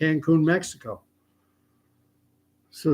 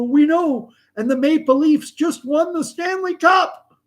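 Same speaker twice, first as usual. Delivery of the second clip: gleeful fans; 13 semitones higher, 4 dB louder than usual. Acted. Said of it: "And they go".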